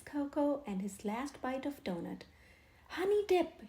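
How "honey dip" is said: higher, louder savouring; lip-smacking relish. A narrator's reading. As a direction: relish the taste. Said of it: "he remarked, smacking his lips".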